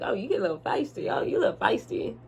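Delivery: quiet, smirking mock tease; smiling tease. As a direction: imitate him teasing through a smile. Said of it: "And he's smiling".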